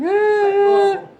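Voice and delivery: high, loud put-on shrugging-off; mock nonchalance. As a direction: brush it off in a mimicking voice. Mimics someone used to cursing.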